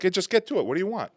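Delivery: loud, high, impatient New Yorker act; abrupt New Yorker. Acted. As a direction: demand they get to the point. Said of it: "they're very abrupt, like New Yorkers".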